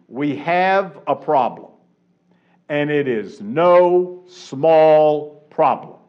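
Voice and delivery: "no small problem" drawn out slowly; slow emphatic example. Shown as a demonstration. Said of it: "For example, if I said to you".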